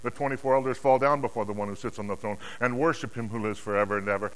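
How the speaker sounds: usual speaking voice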